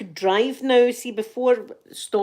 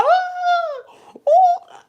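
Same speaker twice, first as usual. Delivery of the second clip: high shrieking cries of alarm; retold panicked screams; 8 dB louder than usual. Trapped in the car by cows, high panicked shrieks. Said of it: "I'm screaming".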